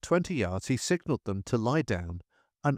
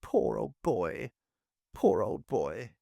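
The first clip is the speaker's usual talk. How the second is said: slow, numbed murmur of the same words; stuck on the phrase, paralysed by horror; slow, dazed repetition, barely there; dazed repeating; slower than usual. A narrator's reading. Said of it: "He could only repeat".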